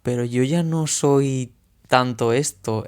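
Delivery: ordinary delivery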